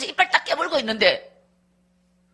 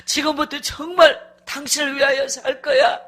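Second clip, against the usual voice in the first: slow, halting tearful promise; imitated weeping plea; 5 semitones higher than usual. Husband promising wife a new life, tearful pleading. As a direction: beg through sobs. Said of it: "울고 있는 소리가 계속 들리는데".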